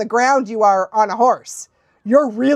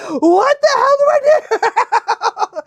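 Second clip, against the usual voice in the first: high, loud, drawn-out wail breaking into laughter; mock panic; 14 semitones higher, 6 dB louder, slower than usual. Retelling a reaction to the chat, laughing mock panic. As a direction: wail it in comic alarm.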